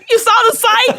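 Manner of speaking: high, loud astonished squeal; shocked disbelief. Real, not acted. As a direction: squeal it, caught completely off guard. Shocked the website was seen.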